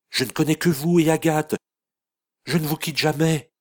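voix aiguë, plus forte, désespérée; exaspérée et suppliante. A narrator's reading. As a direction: se défend, au bord des larmes. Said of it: "dit Lasthénie exaspérée". (fille qui se défend contre l'accusation infâme)

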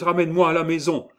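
high, loud, rushed plea; acted frightened outburst; pleading cry. A narrator's reading. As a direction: cry out urgently to be taken home. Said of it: "s'écria Gervaise".

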